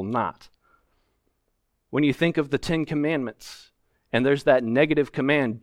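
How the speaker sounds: ordinary talk, nothing marked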